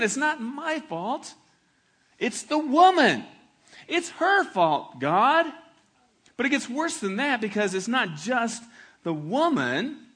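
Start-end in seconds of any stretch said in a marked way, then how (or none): 0.0-5.5 put-on high, slow, loud whine of a child dodging blame; whiny child. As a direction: whine like a kid caught red-handed. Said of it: "They wanna blame it".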